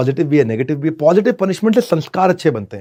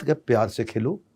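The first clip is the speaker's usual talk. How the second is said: softer and lower, kind; gentle instruction; 4 semitones lower, 8 dB quieter than usual. Shown as a demonstration. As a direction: coax the child sweetly. Gentle alternative.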